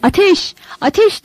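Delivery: çok yüksek, yükselen haykırış; kalabalık gibi ateş diye bağır. A narrator's reading. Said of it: "diye haykırıyordu kalabalık". (kalabalık coşkuyla ateş diye haykırıyor)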